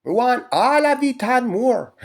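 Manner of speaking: high, slow, squeaky mocking woman; shrill mock of a woman wanting it all; high mock woman's demand. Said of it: "they're like".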